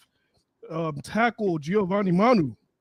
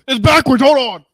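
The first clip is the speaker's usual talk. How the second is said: louder, pitched up; loud protest; 6 semitones higher, 10 dB louder than usual. Real, not acted. Co-host fumbles backwards shoulder pads, blurting it out loud. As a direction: blurt it out while fixing the pads.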